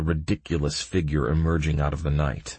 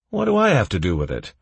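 quick, high, louder protest; 5 semitones higher, 5 dB louder, faster than usual. A narrator's reading. Sword denying he's to blame, protesting. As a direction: object fast, pitched up. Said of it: "protested Sword".

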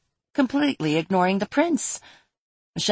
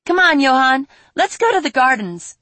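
put-on excited girl; 7 semitones higher, 6 dB louder than usual. Girl calling dragon along.

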